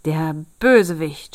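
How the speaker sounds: loud, outraged exclamation; tailor's loud outburst; angry cry. A narrator's reading. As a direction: cry it out in indignation. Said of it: "schrie der Schneider".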